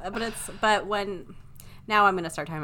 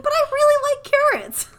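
high, loud, squeaky child imitation; adult mimicking a kid who really likes carrots; little kid voice; 9 dB louder than usual. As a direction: play the five-year-old insisting she loves carrots. Quoting a five-year-old girl.

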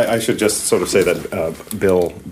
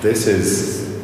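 awed; 3 semitones higher, 4 dB quieter, much slower than usual. Real, not acted. Awed on entering the hidden stairwell.

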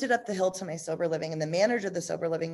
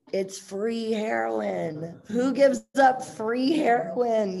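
mock naive; 5 semitones higher, 4 dB louder, much slower than usual. Mocking her old addict logic.

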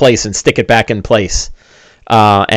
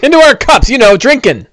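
high-pitched tipsy-friend dwarf voice; jolly dwarf; 11 semitones higher than usual. Acted. Dwarf explains drinking to the puzzled hero, jolly high voice. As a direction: explain it in a high, merry character voice.

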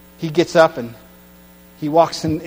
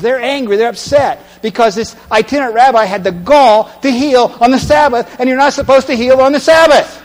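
higher, louder, driving sermon; fiery preaching; 7 semitones higher, 7 dB louder than usual. Acted. Preacher builds outrage at the Pharisees, loud and high. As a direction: preach it hard.

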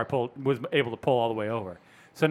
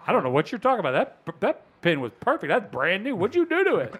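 mock innocent; 7 semitones higher than usual. Feigning innocence about pin.

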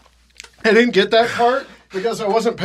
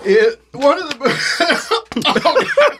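laughing; 6 semitones higher, slower than usual. Real, not acted. Hiccups break up his sentence.

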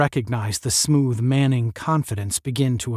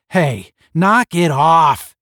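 loud, raised yell, drawn out; 5 semitones higher, 9 dB louder, slower than usual. A narrator's reading. Tom jumps between two squabbling roommates, yelling. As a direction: yell it to break up a fight. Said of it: "yelled Tom".

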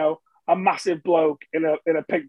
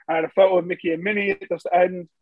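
ordinary delivery

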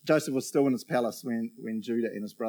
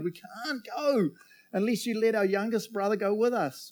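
protesting; 8 semitones higher than usual. Acted. Brothers telling their father they need the youngest, protesting. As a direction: insist in a raised pitch, stretching the first words.

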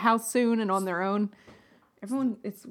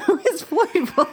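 laughing; 10 semitones higher, 7 dB louder than usual. Retold or imitated. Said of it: "I was like".

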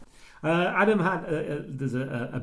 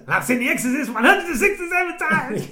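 high-pitched impression of the film character's rant; imitated film line; 12 semitones higher, 4 dB louder than usual. Quoting the film's ad-libbed boast to a co-host, high imitated character voice. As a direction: quote it high, in a put-on character voice. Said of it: "even at the end he goes".

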